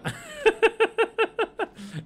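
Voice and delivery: loud, high-pitched rapid cackle; high staccato laugh. Real, not acted. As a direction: burst into high, rattling laughter. Laughs after announcing discovery.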